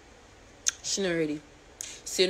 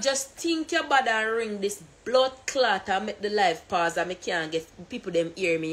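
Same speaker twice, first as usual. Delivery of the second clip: ordinary talk, nothing marked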